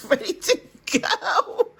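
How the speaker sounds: laughing, high and slow. Real, not acted. Grandma laughing about leaving the grandkids, slow and laughing. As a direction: laugh the words out.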